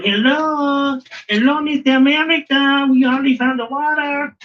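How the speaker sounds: put-on a clumsy Indian accent that slides toward Mexican; bad Indian accent. India mocking America in a joke, bad Indian accent. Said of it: "Why did my Indian sound like a Mexican".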